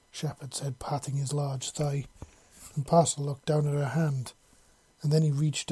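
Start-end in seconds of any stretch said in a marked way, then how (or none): none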